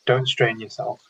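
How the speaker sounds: calm meditation guide with long pauses between phrases; unhurried, measured instructions, leaving silence after each; guided meditation. Real, not acted. Meditation leader guiding an online mindfulness group, calm and spaciously paced.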